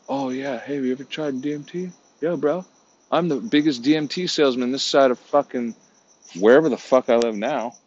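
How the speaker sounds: a salesman bro pitching his product; mock stoner bro. Mocking a drug-selling bro.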